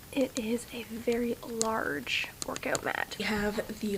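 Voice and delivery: slow hushed whisper. Real, not acted. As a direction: whisper it, slowly. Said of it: "I have to whisper".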